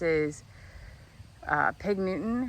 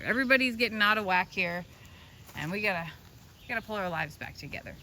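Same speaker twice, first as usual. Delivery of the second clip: ordinary talk, nothing marked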